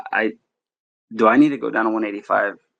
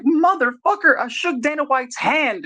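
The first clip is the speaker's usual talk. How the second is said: much higher, slower mock yell; insist, pitched way up; retold yell; 13 semitones higher, slower than usual. Convincing his fighter the deal is legit, high mock yell. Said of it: "I had to yell at him".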